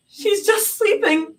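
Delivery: high, choked denial; tearful denial. A narrator's reading. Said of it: "Zoe choked".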